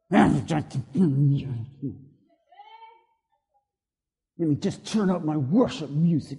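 low, soft mutter to oneself; sheepish mumble. Acted. Driver turning to worship music, muttering sheepishly. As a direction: drop low and soft, as if caught out.

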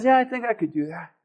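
understated, unenthused yes; reluctant agreement. Retold or imitated. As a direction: toss it off under your voice. Widow's reluctant reply to Elijah.

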